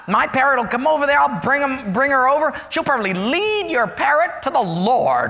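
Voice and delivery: put-on proud little old lady; lightly voiced church lady.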